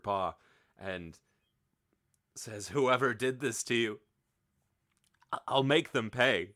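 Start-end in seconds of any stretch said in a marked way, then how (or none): 2.7-6.5 mildly tearful, wounded vow of revenge; understated grieving threat. A narrator's reading. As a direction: swear revenge through a touch of tears. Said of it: "He sobs into".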